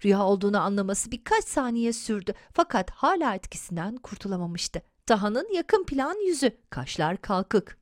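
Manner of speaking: plain reading voice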